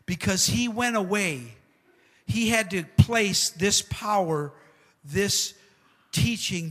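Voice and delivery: fervent pulpit preaching; preaching at full force. Acted. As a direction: build it like a preacher hitting the climax. Preaching climax.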